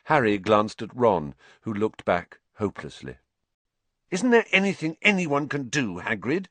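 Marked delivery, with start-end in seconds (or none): 4.1-6.5 high-pitched, urgent plea; intense, insistent question; fierce. A narrator's reading. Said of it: "Harry asked fiercely".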